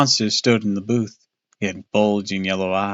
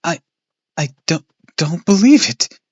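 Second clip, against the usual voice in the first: stammered in shock, louder and higher; stammering; 7 semitones higher, 5 dB louder than usual. Acted. Boy stammering at the exploded car site. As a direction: stumble over the words in disbelief. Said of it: "I stammered".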